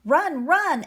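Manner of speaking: high, loud cry to run; echoed alarm. A narrator's reading. A fleeing rabbit warns the others, alarmed. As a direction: pass on the warning urgently. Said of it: "echoed a rabbit as she dashed away".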